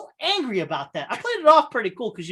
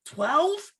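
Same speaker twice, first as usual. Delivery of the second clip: high, rising disbelief; can't believe the number you heard; incredulous question; 8 semitones higher, 3 dB quieter than usual. Real, not acted. Hearing his friend's dose, asking in disbelief.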